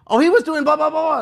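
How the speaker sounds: high, loud mocking imitation; mock an interfering manager, loud and high; manager lady cutting in. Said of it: "now manager lady cuts in".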